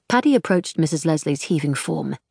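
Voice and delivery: plain reading voice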